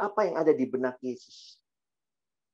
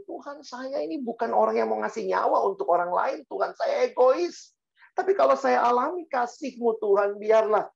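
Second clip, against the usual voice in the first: high, pleading prayer delivery; impassioned prayer; 10 semitones higher than usual. Acted. Preacher confessing selfishness to God, impassioned prayer. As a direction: confess to God with urgent fervour.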